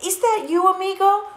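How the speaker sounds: high, eager question; excited. Acted. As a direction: call out to a friend, high and thrilled. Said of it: "exclaimed Scipito".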